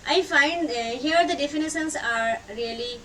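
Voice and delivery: normal talking voice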